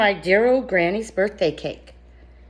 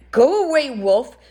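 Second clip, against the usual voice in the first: louder, higher shout of go away; yelling back; 5 semitones higher, 4 dB louder than usual. Acted. Second pig yells at the wolf through the door, sharp shout. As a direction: pig shoos the wolf from inside. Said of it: "he yelled back".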